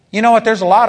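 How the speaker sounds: preacher's shout of a rhetorical question; sudden loud exclamation. Real, not acted. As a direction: burst out high and loud, then drop back.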